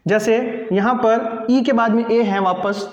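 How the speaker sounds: ordinary talk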